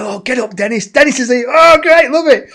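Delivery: high call building to a loud cheer; mock-excited welcome. Retold call to stand up, building.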